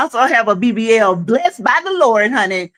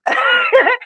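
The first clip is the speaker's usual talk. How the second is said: very high squeal of laughter; piercing giggle burst. Real, not acted. Laughing at own joke.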